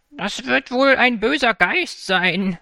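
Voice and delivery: put-on high, slow, worried old woman; anxious. A narrator's reading. Other grandmother answering her sister, high slow anxious. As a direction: fearfully suggest it's a ghost. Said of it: "sagte die andere ängstlich".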